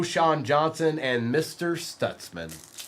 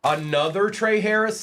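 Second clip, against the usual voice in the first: higher and louder, can't believe the duplicate; disbelieving surprise; 7 semitones higher, 3 dB louder than usual. Real, not acted. Host pulling a repeat card, loud surprised disbelief. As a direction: react to the same card showing up again. Repeat player pulled again.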